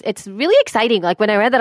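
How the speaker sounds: normal talking voice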